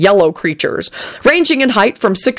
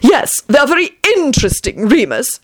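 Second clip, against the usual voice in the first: reproachful; 7 semitones higher than usual. A narrator's reading. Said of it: "informed her reproachfully".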